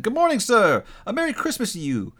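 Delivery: high, hearty street greeting; good-humored greeting. A narrator's reading. Passing fellows greet Scrooge, good-humored and high. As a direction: wish a passer-by well, cheerily. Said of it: "three or four good-humored fellows said".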